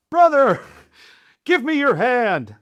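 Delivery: high, loud, overacted plea to a brother; soaring melodramatic hero voice; melodramatic buddy. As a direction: ham it up like a war-movie rescue. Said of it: "kinda one of those, like, melodramatic things".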